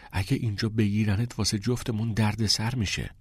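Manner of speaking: ordinary delivery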